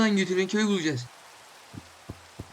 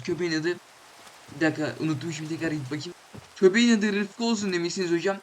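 ordinary talk

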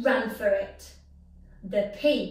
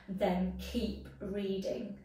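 plain reading voice

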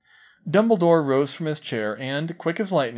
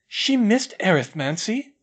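surprised; 7 semitones higher, 5 dB louder than usual. A narrator's reading.

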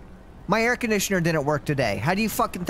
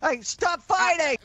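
shouting to stop the fight; 9 semitones higher, slower than usual. Acted. Breaking up a brawl.

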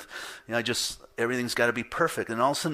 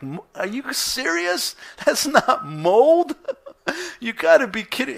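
high mock-outraged laughing retort; laughing disbelief; 13 semitones higher, 7 dB louder than usual. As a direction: act like you can't believe what you heard. Retold reaction, mold claim.

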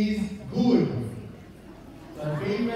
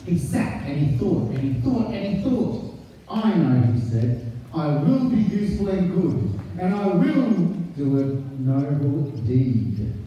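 put-on lively read-aloud voice for children; story narration; 4 semitones lower than usual. A narrator's reading. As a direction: read the picture book to the class. Teacher reads picture book aloud.

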